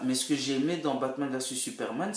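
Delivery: ordinary talk, nothing marked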